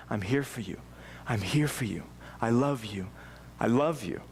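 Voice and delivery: low, gentle reassurance with small pauses; tender father. Acted. Father's comforting words repeated.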